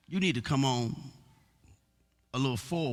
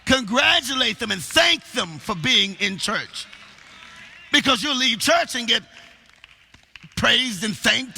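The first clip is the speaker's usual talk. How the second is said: raised, higher preaching voice; forceful preaching; 8 semitones higher, 5 dB louder than usual. Acted. Preacher urging the church to praise people, forceful preaching. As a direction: press it home with stretched key words.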